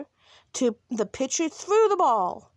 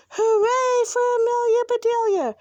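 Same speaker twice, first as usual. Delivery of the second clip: high, loud team hooray; cheering shout; 11 semitones higher, 5 dB louder than usual. A narrator's reading. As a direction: cheer like a gang of happy boys. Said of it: "shouted the boys".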